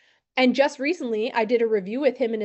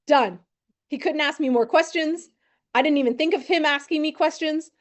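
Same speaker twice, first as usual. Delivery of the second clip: ordinary talk, nothing marked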